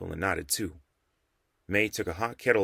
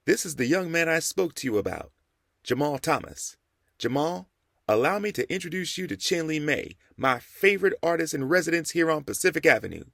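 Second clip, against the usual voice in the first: higher formal introduction; courtly old preacher; 8 semitones higher than usual. A narrator's reading. The Rev formally introduces Jamal to Mei, courtly and higher. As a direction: present the young man graciously.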